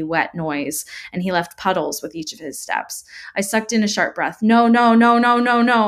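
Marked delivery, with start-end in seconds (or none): 4.4-5.9 loud, high string of frightened cries; panicked whimpering. A narrator's reading. Child stumbling away from David, panicked whimpering. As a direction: back away pleading in rising panic. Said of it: "I whimpered as I stumbled further away".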